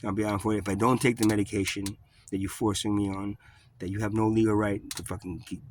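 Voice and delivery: normal talking voice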